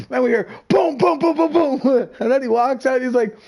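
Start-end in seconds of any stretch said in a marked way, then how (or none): 0.7-2.1 high, punchy rapid booms; imitated banging. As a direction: voice the thuds coming from the bathroom. Imitates banging heard from bathroom.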